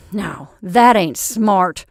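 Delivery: slow, loud sneer. A narrator's reading. Thin Santa Claus to Mrs Gratz, a loud slow sneer. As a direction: scoff at her down your nose. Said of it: "sneered the thin Santa Claus".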